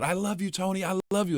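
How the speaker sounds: high, soft imitation of a screaming fan; mock fan scream. Comic retelling of a woman yelling from the crowd, mock scream. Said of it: "she's screaming out".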